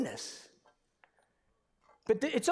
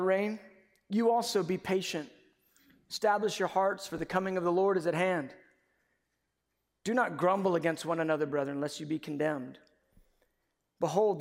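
measured, lower reading of the Bible passage; scripture reading; 4 semitones lower than usual. Acted. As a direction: read the verses with solemn weight. Reads scripture aloud before preaching.

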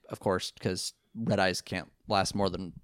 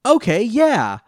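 high, loud burst of agreement; hammed-up eager okay; excited yes; 12 semitones higher, 12 dB louder, slower than usual. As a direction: blurt it out, suddenly sure. [name]'s big acted reply.

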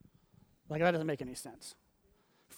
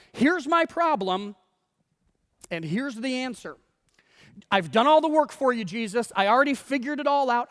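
hammed-up, higher and louder know-it-all; acted insistence, pitched up; mock bossy complaint; 5 semitones higher, 6 dB louder than usual. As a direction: present the case to Jesus as already settled. Acting Martha bossing Jesus.

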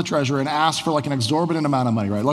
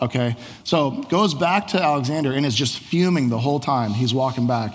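ordinary talk, nothing marked

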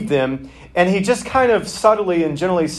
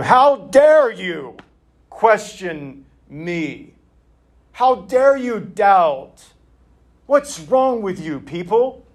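mock stern scolding, higher and slow; stern rebuke; 4 semitones higher, slower than usual. Imagined Jesus scolding doubting disciples, mock stern. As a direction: ham up an indignant teacher's rebuke. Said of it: "give them the brow like Matthew would".

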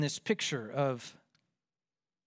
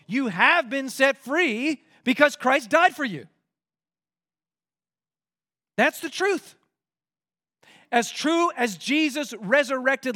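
higher-pitched, driving sermon delivery; emphatic preaching; 7 semitones higher, 5 dB louder than usual. Real, not acted. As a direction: preach it with conviction, punching the key words. Preaches with rising force.

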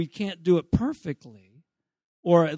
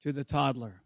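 normal talking voice

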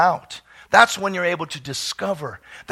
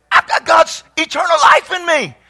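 "I've got God's eternal life in me" shouted higher and louder; loud declaration; 8 semitones higher, 6 dB louder than usual. Shown as a demonstration. Pastor leading the congregation, "I've got God's eternal life in me" shouted out loud. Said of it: "So look at your neighbor and say that".

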